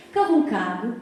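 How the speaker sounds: ordinary talk, nothing marked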